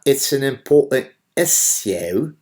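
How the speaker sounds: mock the British "issue" in a put-on accent. Commentator mocks a British host's "issue" in a put-on British accent.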